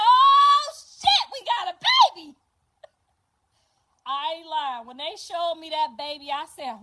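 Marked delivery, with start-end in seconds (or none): 0.0-2.4 high, loud, fast cry of joy; excited shout. Retold or imitated. Grandma shouting joy at the new baby. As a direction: shout the news.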